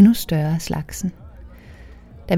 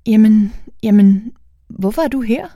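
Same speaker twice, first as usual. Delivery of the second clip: louder, then higher startled stammer; flustered surprise; 4 semitones higher, 4 dB louder than usual. Acted. Girl caught by mother's partner, flustered. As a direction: blurt it, caught out. Caught half-undressed by stepdad.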